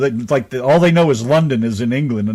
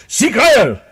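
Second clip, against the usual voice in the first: a playful mock German accent, high and loud; wife teasing her husband in mock German; 10 semitones higher, 5 dB louder than usual. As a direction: bark it in a jokey fake German accent. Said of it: "that German thing".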